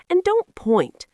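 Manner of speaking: high, firm mother's correction; scolding mother. A narrator's reading. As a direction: tell her off gently. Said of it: "said her mother".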